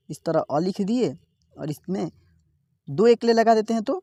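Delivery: ordinary delivery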